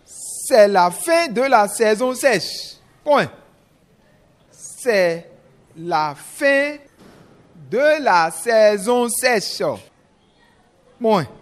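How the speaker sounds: slow, chunked dictation; dictating. Shown as a demonstration. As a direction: dictate each phrase slowly. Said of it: "je vais lire une première fois".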